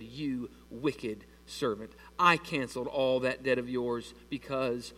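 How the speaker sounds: low, slow, measured reproach; stern rebuke. A narrator's reading. Master confronting the unforgiving servant, stern rebuke. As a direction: deliver it slowly and gravely, word by word.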